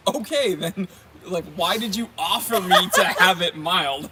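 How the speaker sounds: loud, incredulous retort; mock exasperation. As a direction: protest it loudly, half in jest. Retells his exasperated reply to server.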